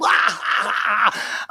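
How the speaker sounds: re-enacted big, high laugh; long, hammed-up burst of laughter; doubled-over laughing. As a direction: act out a helpless laughing fit. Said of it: "I'm doubled over laughing".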